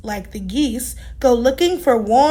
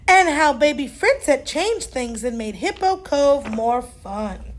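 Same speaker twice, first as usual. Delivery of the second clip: usual speaking voice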